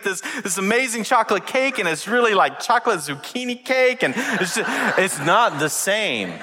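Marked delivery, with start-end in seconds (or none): none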